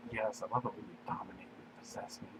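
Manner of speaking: soft unvoiced whisper. William whispering back to Daenerys. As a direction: say it under your breath.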